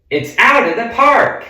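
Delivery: high, loud crowing boast; cocky boast. Pretend. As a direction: brag like a slugger sure of a homer. Imagined Babe Ruth boast.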